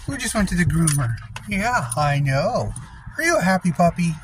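high sing-song pet voice; talking to a dog. Real, not acted. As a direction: coo at your dog. Owner praising dog after grooming.

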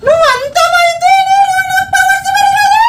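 very high, slow, strained yell; menacing villain shout. Acted. Prankster threatens boy to gain powers, villainous shout. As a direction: howl the threat like a possessed villain.

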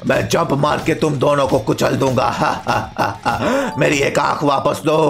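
low, slow villain robot; deep, deliberate boss voice; menacing robot principal. Acted. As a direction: threaten like an evil robot headmaster.

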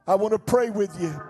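ordinary delivery

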